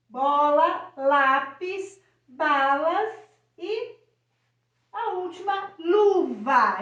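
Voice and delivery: put-on each word said loud and clear, as if for a child; clear word demo. Shown as a demonstration. Teacher showing parents how to say the words, loud and clear. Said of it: "Como é que a gente fala isso?".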